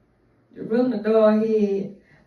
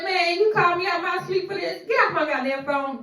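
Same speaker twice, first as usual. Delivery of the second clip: annoyed husband; 6 semitones higher than usual. Retold or imitated. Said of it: "He said".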